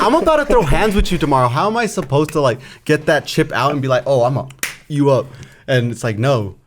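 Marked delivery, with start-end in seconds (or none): 0.0-5.3 excited disbelief. Retold or imitated. Retelling own inner dilemma, laughing.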